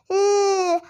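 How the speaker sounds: ल as a held "lll", not "double el"; phonics L sound. Shown as a demonstration. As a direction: hold ल on the tongue. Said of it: "क्या पढ़ेंगे?". (लंबी खिंची ल ध्वनि)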